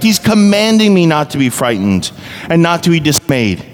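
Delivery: high, ringing sermon delivery; impassioned preaching. Real, not acted. Pastor declaring God's promise to his congregation, impassioned preaching. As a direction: preach it with rising conviction, pitched well up.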